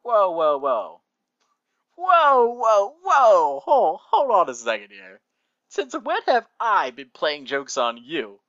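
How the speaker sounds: hammed-up, loud, high whoas settling into indignation; big theatrical disbelief, starting very high; over-the-top protest. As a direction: overplay the shocked objection. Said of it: "very over the top".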